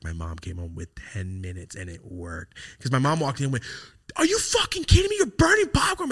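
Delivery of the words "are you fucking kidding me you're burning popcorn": high, loud outraged shout; furious mom yell. Retold or imitated. Mom catching her son burning popcorn, furious shout.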